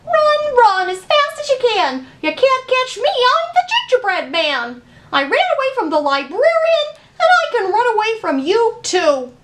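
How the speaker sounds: high, loud sing-song taunt; taunting gingerbread man. Acted. Gingerbread man taunts the librarian, high and loud. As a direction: tease them as you run off.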